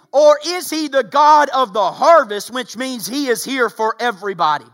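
loud, high, fervent sermon delivery; rising revival preacher cry; preaching at full force. Acted. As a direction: preach it hard to the whole room. Preacher builds again.

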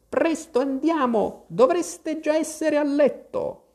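very high, trembling canary. A narrator's reading. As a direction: summon your little ones in a high, slow quaver. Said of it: "con voce tremante". (voce tremante e acutissima)

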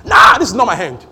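loud, defiant outburst; intense talk. Acted. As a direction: blast it out in defiance. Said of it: "Talk even more intensely".

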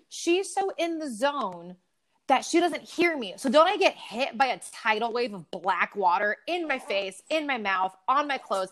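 normal talking voice

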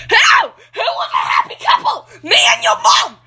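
high screaming back and forth; berserk rage. Acted. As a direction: scream it wildly, out of control. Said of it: "please stop yelling".

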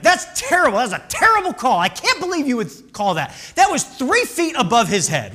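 loud, high, heated complaint; yelling at the umpire. Retold or imitated. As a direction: bark your outrage across the field. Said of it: "I chirped at him. I was like".

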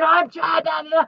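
high, loud mock meltdown; exploding. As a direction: burst out shouting as you re-enact losing it. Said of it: "led to me exploding".

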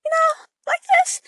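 very high squeaky excited voice. Shown as a demonstration. Demonstrating how her voice climbs when excited, very high. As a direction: jump way up into head voice. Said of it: "when I get excited".

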